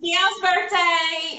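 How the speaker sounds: Dion's birthday étiré, lent et appuyé; drawn-out emphasis. Real, not acted. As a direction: lean into the name, slowly. Echoing the birthday news, drawn out.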